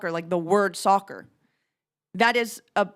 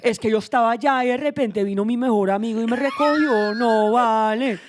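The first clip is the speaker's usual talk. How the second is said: Sean Connery sad story; 4 dB louder than usual. Acted. Friend demonstrating how an accent turns plain facts tragic, mock-sorrowful Spanish. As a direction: tell everyday news in Spanish as if it were a heartbreaking tale. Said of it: "sounds like Sean Connery telling you a really sad story".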